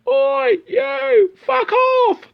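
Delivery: high-pitched, loud mock yell; hammed-up high holler from outside; passer-by shouting. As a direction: yell it like a heckler outside the window. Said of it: "Or someone going".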